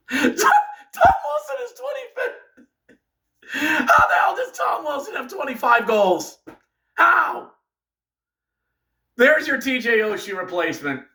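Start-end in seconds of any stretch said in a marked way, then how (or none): 0.1-2.7 high, cracking laugh through the words; laughing in disbelief. Real, not acted. Fan laughing in disbelief at Tom Wilson's goals, cracking up. Disbelief at rival's goal total. 3.5-7.5 higher, outraged demand; exasperated rant. Real, not acted. Fan ranting at his team about Tom Wilson, outraged.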